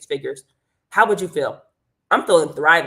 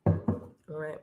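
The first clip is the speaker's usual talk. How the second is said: normal talking voice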